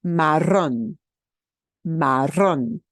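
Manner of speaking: marrón with trilled rr and a stressed rón; stress last syllable. Shown as a demonstration. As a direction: roll the rr, punch the rón. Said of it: "punch that last syllable harder".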